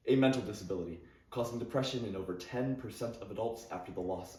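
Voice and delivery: soft, grave news-style read; documentary narration. Acted. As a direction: announce it like a sombre report.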